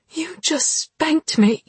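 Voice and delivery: shocked high whisper; disbelieving. Acted. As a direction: gasp it out in disbelief. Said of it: "she said disbelievingly".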